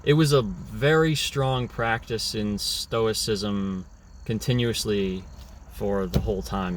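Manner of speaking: ordinary talk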